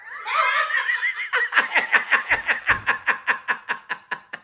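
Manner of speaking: high, trailing real laugh; real laughter fading out. Real, not acted. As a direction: laugh at your own punchline. Teller cracks up at punchline.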